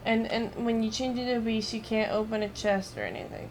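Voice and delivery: ordinary talk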